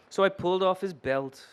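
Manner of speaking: ordinary delivery, nothing marked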